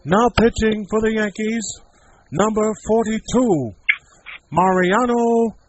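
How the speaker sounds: slow, loud stadium announcer call; Bob Shepard PA announcer. Retold or imitated. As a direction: boom it out like a ballpark PA. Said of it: "I got another one for you".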